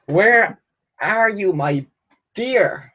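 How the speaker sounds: high, drawn-out sing-song call; high lilting "where are you"; playful searching call. Pretend. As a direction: call for it, stretching each word. Calls out searching for a question.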